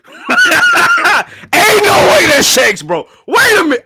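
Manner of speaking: high, loud, stretched-out shouts; screaming in disbelief. Real, not acted. Streamer reacting to seeing Shanks, screaming in disbelief. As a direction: shout as if it cannot be real.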